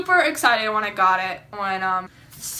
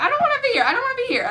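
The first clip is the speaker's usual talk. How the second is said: much higher, half-joking panic; panicked; 13 semitones higher, 4 dB louder, much faster than usual. Retold or imitated. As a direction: squeal it like you're freaking out. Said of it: "I was, like, freaking out".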